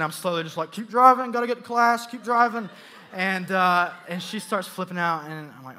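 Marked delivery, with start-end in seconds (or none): 0.7-2.7 higher, louder retold plea; urgent urging. Student urging bus driver to get to class, urgent. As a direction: urge the driver on in a raised voice.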